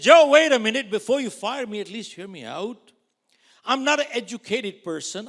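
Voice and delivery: loud, high pleading cry; desperate pleading. Retold or imitated. Young agent begging his manager, loud high pleading. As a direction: beg him loudly to listen. Begging the boss not to fire.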